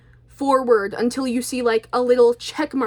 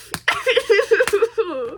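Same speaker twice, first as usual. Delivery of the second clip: maniacal laugh; 9 semitones higher than usual. Pretend. Vlogger annoyed at not being believed, mock maniacal laughter. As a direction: laugh like a comic psychopath.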